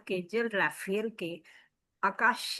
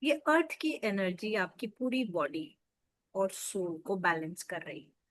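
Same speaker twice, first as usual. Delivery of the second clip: softer, lower, soothing meditation lead; guided meditation; 3 semitones lower, 4 dB quieter than usual. Acted. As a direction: gently guide listeners into deep relaxation, leaving space between phrases.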